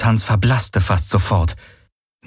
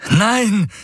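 high-pitched cry of refusal; screamed; 14 semitones higher than usual. A narrator's reading. Valyr's hologram trying to stop the shot, screaming. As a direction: cry out in alarm, pitch shooting up. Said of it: "schrie Valyrs Hologramm".

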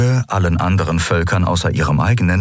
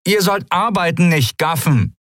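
high-pitched scolding call; called reprimand; 11 semitones higher than usual. A narrator's reading. Pirate woman scolding her idle companions, calling out. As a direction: order the gawkers back to work, high-voiced. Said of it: "rief die Menschenfrau mit dem Apfel ihren Kumpanen zu".

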